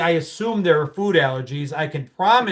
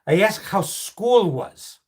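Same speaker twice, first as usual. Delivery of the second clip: put-on slow, deliberate Greek-accented dad; 2 semitones higher, slower than usual. Dad trapping his lying son, slow Greek accent. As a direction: drag it out like a Greek father who knows. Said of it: "Clock the, the Greek accent".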